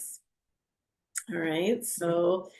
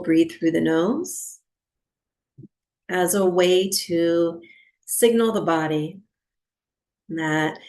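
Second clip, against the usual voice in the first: unhurried meditation guide, long pauses between phrases; guided meditation. Real, not acted. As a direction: settle listeners into stillness, phrase by phrase.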